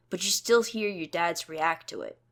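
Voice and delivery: usual speaking voice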